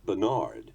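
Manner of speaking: "Bernard" as ber-NARD, weight hurled on the end; final-syllable stress on "Bernard"; American French. Shown as a demonstration. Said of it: "hurl the emphasis onto the final syllable".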